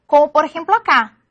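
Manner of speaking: usual speaking voice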